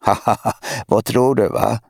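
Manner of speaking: little sister giggling at her brother; teasing laugh. A narrator's reading. Younger sister laughing at her brother's struggle. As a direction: laugh him off, mocking. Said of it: "skrattade hans två år yngre syster Elinor".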